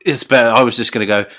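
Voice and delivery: ordinary talk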